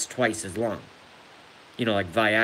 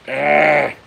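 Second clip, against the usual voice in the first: sharp loud ah of pain, cut off mid-laugh; pained cry from laughing; 4 semitones higher, 9 dB louder than usual. Real, not acted. Streamer yelps in pain laughing at his own joke, loud pained cry. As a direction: yelp as the laugh hurts his injury. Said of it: "I can't laugh and I can't cough".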